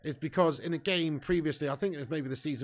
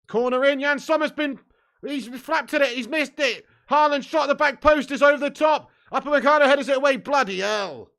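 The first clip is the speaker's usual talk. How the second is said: much higher, louder fast play-by-play; excited commentary; 10 semitones higher, 10 dB louder than usual. Real, not acted. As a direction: call the scramble in a hyped rush. Live reaction to City corner.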